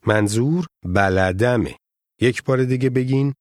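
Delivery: ordinary delivery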